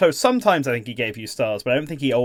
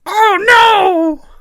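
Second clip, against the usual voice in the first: very high, loud squeaky Toad cry; tiny mushroom panic; 10 dB louder than usual. Shown as a demonstration. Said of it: "Like that".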